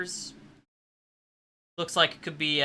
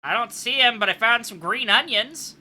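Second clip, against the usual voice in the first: high, raised-voice report; pitched-up holler to another floor; calling back up; 8 semitones higher, 5 dB louder than usual. Acted. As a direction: call the news up the stairs. Calls up from downstairs.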